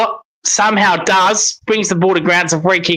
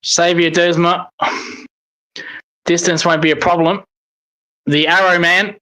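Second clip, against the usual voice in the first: ordinary talk, nothing marked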